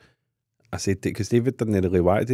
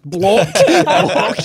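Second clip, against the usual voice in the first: laughing mock panic; 9 semitones higher, 7 dB louder than usual. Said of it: "I was like".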